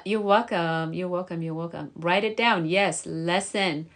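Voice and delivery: ordinary talk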